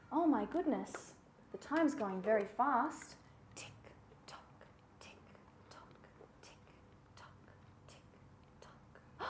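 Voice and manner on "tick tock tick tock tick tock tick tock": whispered, evenly spaced clock ticks; tiny, measured whispered beats; ticking clock. Acted. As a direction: whisper each tick like a clock.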